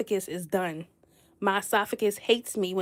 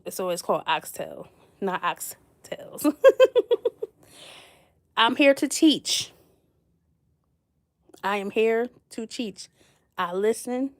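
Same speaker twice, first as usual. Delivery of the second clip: usual speaking voice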